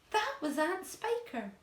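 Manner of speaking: high, slow victorious cry; shouted. A narrator's reading. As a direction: call out the second hit with glee.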